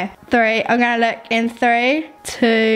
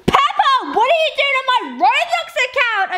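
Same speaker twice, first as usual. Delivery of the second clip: high, sudden squeal of surprise; surprised squeal; 11 semitones higher, slower than usual. Acted. Player catching Peppa on her Roblox account, high surprised squeal. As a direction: shriek it like you caught someone.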